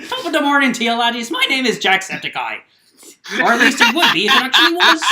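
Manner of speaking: loud, high Irish YouTuber greeting; hammed-up Irish accent, loud and high; Jacksepticeye impression. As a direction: shout it like an over-the-top Irish streamer intro. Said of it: "when did Jacksepticeye become part".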